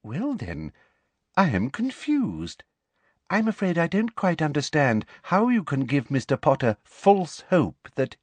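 prim, needling politeness; simpering professor. Acted. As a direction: challenge with sweet false puzzlement. Umbridge feigning confusion.

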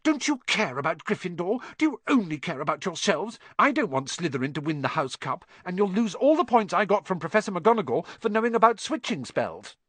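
angry hissing. A narrator's reading. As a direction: nag crossly in one insistent rush. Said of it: "hissing at them like an angry goose".

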